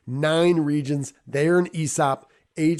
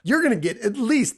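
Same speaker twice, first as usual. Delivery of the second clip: ordinary talk, nothing marked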